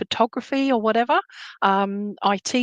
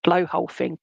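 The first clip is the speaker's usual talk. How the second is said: whale impression. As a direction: moan it out like a whale. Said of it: "That was my whale impression".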